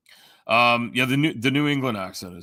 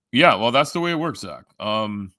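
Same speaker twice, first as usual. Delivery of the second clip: ordinary delivery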